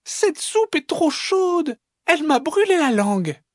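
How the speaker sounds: very high little fox voice; little fox complaining. Acted. Little fox burnt by hot soup, squeaky complaint.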